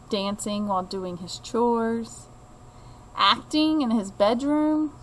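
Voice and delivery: ordinary delivery, nothing marked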